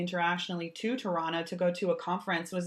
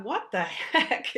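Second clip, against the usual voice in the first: drawn-out, higher mock bafflement; baffled reaction; 5 semitones higher, much slower than usual. Podcast host retelling her thought at the stranger's remark, baffled. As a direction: stretch it out in amused disbelief.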